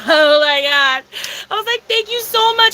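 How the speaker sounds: hammed-up amazed wail; overwhelmed gratitude. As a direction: act out being floored by someone's kindness. Retold overwhelmed thanks for the gift.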